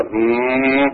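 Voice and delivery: long steady hum, rising a little; imitated humming noise. Man recalling a strange noise in the dark room, imitated hum. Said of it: "I'd heard this humming noise".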